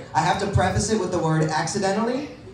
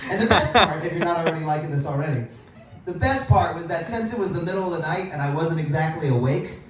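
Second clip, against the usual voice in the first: ordinary delivery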